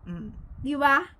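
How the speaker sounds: ordinary talk, nothing marked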